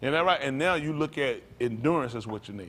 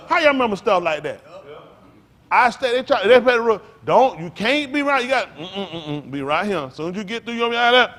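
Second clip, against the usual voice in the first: loud and higher, getting carried away; excited storytelling; 6 semitones higher, 9 dB louder than usual. Real, not acted. Preacher to congregation, recalling playground tag, louder and excited. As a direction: relive the game with rising excitement.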